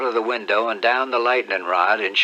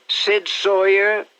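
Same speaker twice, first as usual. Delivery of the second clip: slow, higher imitation of a delighted aunt; a light hint of Aunt Sally's welcome; boy mimicking an aunt opening her arms; mock aunt greeting; 9 semitones higher, 4 dB louder, much slower than usual. Sid mimics aunt's greeting.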